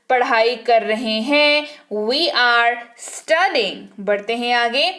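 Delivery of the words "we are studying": very slow, careful model sentence; very slow dictation. Shown as a demonstration. Teacher dictating to the class, very slow. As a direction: drag each word out for learners. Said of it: "teacher repeats model sentence".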